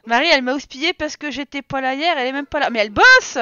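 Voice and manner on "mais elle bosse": loud retort. Real, not acted. Retorting about Marie.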